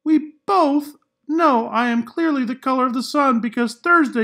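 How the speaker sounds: a cocky crayon showing off; Orange Crayon voice. Acted. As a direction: brag like a smug orange crayon. Orange Crayon letter read in character.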